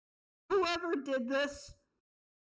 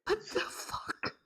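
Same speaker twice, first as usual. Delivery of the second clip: whisper; 6 semitones higher, 5 dB quieter, slower than usual. Real, not acted. Stunned by character's death.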